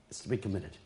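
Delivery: quiet repeat of committed. Real, not acted. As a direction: let it fall to a hush. Repeat dropped to a hush.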